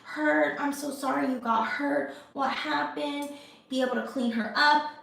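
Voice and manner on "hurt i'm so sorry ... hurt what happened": put-on comforting a child. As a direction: gently soothe a hurt kid. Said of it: "I have to be able to say".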